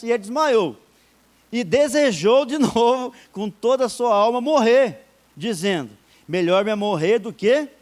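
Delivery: loud, proclaiming Bible verse; emphatic scripture reading. Acted. Preacher reads Jonah's story aloud to the church, loud.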